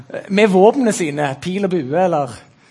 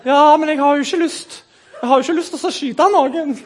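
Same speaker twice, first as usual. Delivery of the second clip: high, pleading self-parody; hammed-up timid objection; meek conscientious objector; 6 semitones higher than usual. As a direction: protest like a reluctant softie. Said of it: "jeg er nok litt lik han der godeste Jakob".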